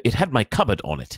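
Harry angry. A narrator's reading. Boy proving the letter was his, angry. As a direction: argue the point crossly. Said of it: "said Harry angrily".

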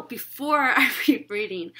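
plain reading voice

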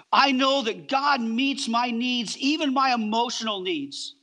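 ordinary talk, nothing marked

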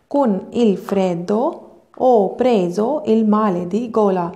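slow, careful Italian, each word apart; slow model reading. Shown as a demonstration. Teacher models the Italian sentence slowly to students. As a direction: read it out slowly for the class. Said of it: "ਤੁਸੀਂ ਧਿਆਨ ਦਿਓ ਕਿ ਮੈਂ ਕਿੱਦਾਂ ਪੜ੍ਹ ਰਹੀ ਹਾਂ".

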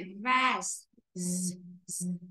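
a soft buzzing z held alone; isolating the sound. Shown as a demonstration. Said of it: "nếu đọc cho âm này".

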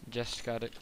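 usual speaking voice

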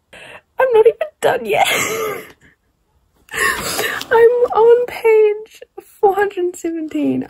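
crying while talking, high and slow; half laughing, half crying; tearful. Real, not acted. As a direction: voice wobbling through real tears. Emotional about finishing the book.

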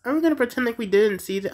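fast, high, disbelieving; excited. Real, not acted. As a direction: rattle it off in disbelief. Excited reaction.

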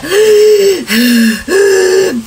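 a long, high, strained gasp for air; loud strained gasp. Shown as a demonstration. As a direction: suck in air like you're choking. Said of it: "nung narinig niya na may humihinga". (malakas at hirap na paghingal)

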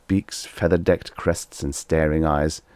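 ordinary delivery, nothing marked

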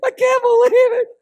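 hammed-up wailing, high and loud; mock-sob in overwrought sympathy; crying. Mercy-gifted friend at Ken's sickbed, melodramatic crying.

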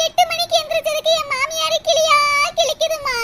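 whining grievance breaking into sobs; tearful complaint. Acted. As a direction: wail about being scolded, hammed-up crying. Crying daughter complains of scolding.

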